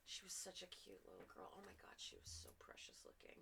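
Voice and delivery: whispered gushing aside; hushed aside. Real, not acted. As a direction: drop to a whisper for a little aside. Aside gushing over young actress.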